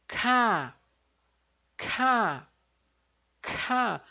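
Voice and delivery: "K" with a puff of air; aspirated K. Shown as a demonstration. Teacher demonstrating the aspirated Korean K. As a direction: breathe out hard on "K". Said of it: "Vai ficar agora aspirado".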